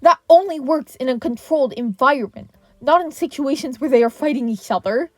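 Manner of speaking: furious yell of correction. A narrator's reading. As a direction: shout it at the incompetent teacher. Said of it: "All Might yelled".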